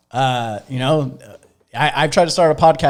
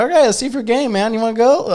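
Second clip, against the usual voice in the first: excited challenge; 10 semitones higher, 4 dB louder than usual. Retold or imitated. Challenging a friend to commit.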